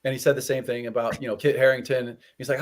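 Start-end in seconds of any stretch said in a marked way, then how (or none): none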